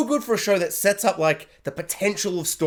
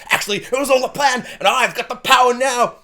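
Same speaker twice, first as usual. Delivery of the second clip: triumphant reveal; 7 semitones higher, 4 dB louder than usual. Retold or imitated. Acts out Eren revealing his plan.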